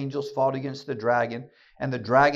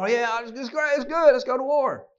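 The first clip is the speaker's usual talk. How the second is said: high, eager mock cheer; mock enthusiasm; 10 semitones higher, 5 dB louder than usual. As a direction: play up fake eagerness. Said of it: "that we're all".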